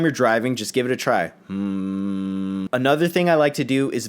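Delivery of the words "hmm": steady low humming note; low hum. Shown as a demonstration. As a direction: hold a long, low hum. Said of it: "hum very low in your spare time".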